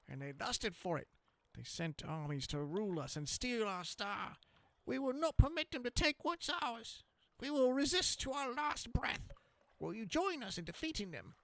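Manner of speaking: high, gruff squeaking rat voice. Acted. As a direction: growl it out like a proud rat rebel. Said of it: "try to do a rat voice that is gruff".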